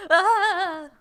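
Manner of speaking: loud high panicked scream. Acted. Damien grabbing dad's hand at the gory scene, loud high scream. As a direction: shriek as blood splatters the screen. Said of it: "Damien screams again".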